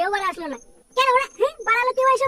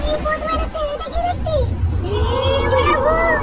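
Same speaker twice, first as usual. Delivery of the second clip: terrified; 4 semitones higher, slower than usual. Acted. Sees a ghost.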